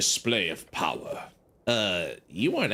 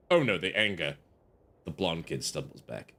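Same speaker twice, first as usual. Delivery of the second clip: ordinary talk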